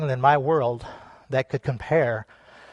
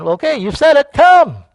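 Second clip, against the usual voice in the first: high, loud go-ahead; acted, raised-voice invitation; calling out; 9 semitones higher, 7 dB louder than usual. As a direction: build to a shouted summons. Acting Jesus answering Peter.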